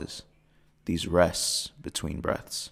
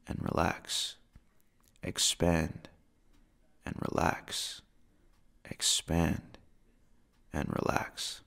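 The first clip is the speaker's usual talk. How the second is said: fading whisper; 6 dB quieter than usual. Acted.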